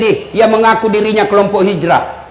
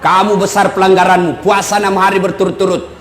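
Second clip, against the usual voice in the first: Prophet's gentle answer; 6 semitones lower than usual. Acted.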